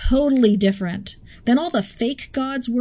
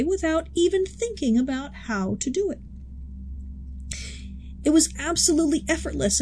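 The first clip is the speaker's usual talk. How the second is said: ordinary delivery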